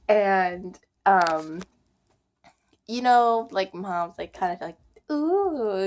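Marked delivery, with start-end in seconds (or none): 5.1-5.9 high-pitched cooing tease; teasing moms. Retold or imitated. Imitating how moms react to crushes.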